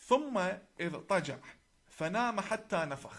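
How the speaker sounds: measured Arabic scripture recital; Arabic recitation. Shown as a demonstration. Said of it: "I will recite in Arabic".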